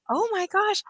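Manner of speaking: high, bright retold gasp of discovery; retold amazement. Coach reliving the moment she found the fix, amazed. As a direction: replay the moment it clicked.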